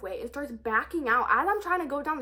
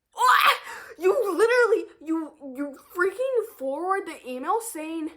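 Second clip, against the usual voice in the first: high, loud, laughing disbelief; outraged shout; 5 semitones higher, 7 dB louder than usual. Real, not acted. Yelling at mom over the canceled appointment, stunned.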